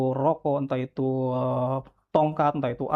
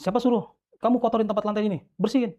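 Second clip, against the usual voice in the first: higher-pitched imitation of a scolding officer; retold scolding; 6 semitones higher than usual. Officer scolding a detainee over the dirty floor, imitated scolding. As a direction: reenact a telling-off, pitched up, not shouted.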